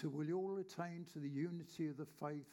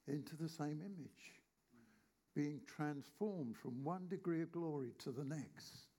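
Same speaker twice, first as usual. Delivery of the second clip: ordinary talk, nothing marked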